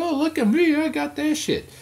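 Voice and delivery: high, smug bragging voice; mock show-off. Showing off his medals to viewers, high smug bragging. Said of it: "and be like".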